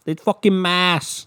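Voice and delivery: put-on loud high Boston accent, drawn out; hammed-up Boston accent; mock Boston accent. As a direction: play a Boston local, slowly. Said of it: "with their fucking thick accents".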